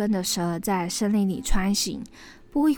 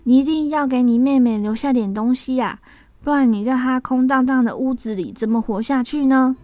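raised, louder pleading voice; urging; 5 semitones higher, 6 dB louder than usual. A narrator's reading. Neighbor urging the brother to leave something for his sister, pleading. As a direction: press the case for someone vulnerable. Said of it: "就劝这个哥哥说".

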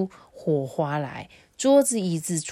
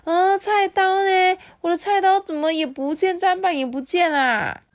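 a whole town crying out at once; panicked shouting; 13 semitones higher, 6 dB louder than usual. Acted. Townsfolk calling out for their missing knives, alarmed shouting. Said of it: "家家户户都传来的这个呼喊声".

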